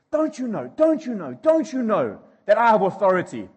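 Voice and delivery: high, loud, frantic repetition; exasperated Pilate. Retold or imitated. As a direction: tear your hair out and plead-yell. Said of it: "pulling his hair out and just saying".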